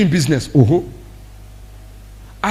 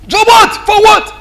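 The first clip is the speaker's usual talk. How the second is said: much higher, louder defiant cry; defiant shout; 13 semitones higher, 5 dB louder than usual. Retold or imitated. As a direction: yell like someone who answers to no one. Defiant member voiced loud.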